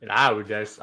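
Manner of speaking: usual speaking voice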